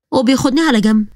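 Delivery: ordinary delivery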